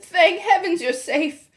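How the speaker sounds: slow, tender grandmother; tender relief. A narrator's reading. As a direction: breathe it out with relief.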